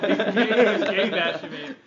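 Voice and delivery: laughing through the words. Real, not acted. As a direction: giggle it out slowly. Joking banter, laughing.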